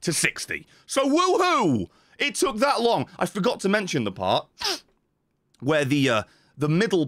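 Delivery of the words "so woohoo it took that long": high, drawn-out woohoo; sarcastic high-pitched whoop; mock cheer. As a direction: celebrate a tiny win, high and stretched. Mock celebration of tiny win.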